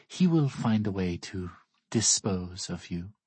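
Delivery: put-on slow, measured child character; a hint of a grave child, words drawn out; lightly voiced solemn child. Child warning visitors about Fawns, lightly voiced, slow and solemn.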